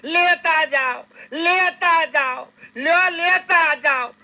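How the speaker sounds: high, slow wailing call after the departing holy man; calling out after him. Retold or imitated. Wife calls after the fleeing holy man, high drawn-out wailing shout. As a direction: stretch the call out high, half crying. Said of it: "બૂમ પાડી".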